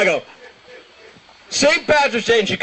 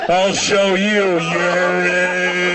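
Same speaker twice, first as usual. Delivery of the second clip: slow, long-held mock threat; defiant old man; much slower than usual. Old man imagined wetting himself, slow defiant drawn-out announcement. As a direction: stretch the last word out defiantly. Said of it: "in my mind, he must have".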